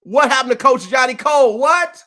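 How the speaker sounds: surprised. Real, not acted. Host spots a guest missing.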